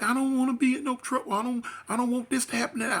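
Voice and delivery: high, fast worried pleading; scared girlfriend. Retold or imitated. Girlfriend begging not to get in trouble, high and scared. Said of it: "She like".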